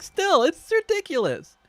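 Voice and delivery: high, loud, drawn-out complaint; slow, incredulous high whine; exasperated. Real, not acted. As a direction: let the exasperation squeal upward. Exasperated at noisy console.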